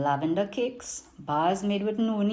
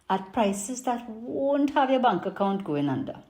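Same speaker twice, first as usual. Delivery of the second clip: put-on lively Trini market vendor accent; Trinidadian accent; 2 semitones higher than usual. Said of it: "I'm gonna do it in my best Trini market accent".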